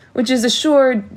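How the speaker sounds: can barely speak for laughing; laughing through words. Acted. Prince to the bride's family, helplessly laughing. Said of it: "peals of laughter".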